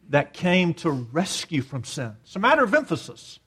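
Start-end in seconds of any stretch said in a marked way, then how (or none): 2.2-3.4 quick, higher-pitched point; quick remark. Real, not acted. Preacher explaining focus at the table, quick and pointed. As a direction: toss it out briskly. First take of repeated remark.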